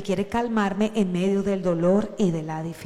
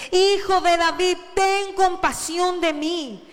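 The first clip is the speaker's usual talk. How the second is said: high, loud, drawn-out cry; pleading yell, pitched high and stretched out; shouting even louder; 7 semitones higher, 4 dB louder, slower than usual. A narrator's reading. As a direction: shout harder over the crowd. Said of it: "él gritó aún más fuerte".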